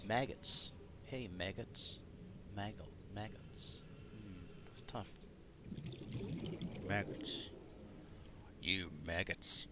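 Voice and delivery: testing "maggots" quietly with an American "mæɡəts"; quiet accent practice. Shown as a demonstration. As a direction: mutter "maggots" to find the American vowel. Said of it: "how would an American say maggots?".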